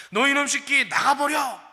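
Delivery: a father roaring at his son to get out; angry father's shout. Retold or imitated. Pastor voicing a furious father throwing out his son, slow loud shout.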